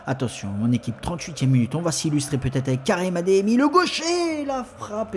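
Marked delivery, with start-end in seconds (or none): 3.6-5.2 excited sports commentary. Acted. Shot in commentary.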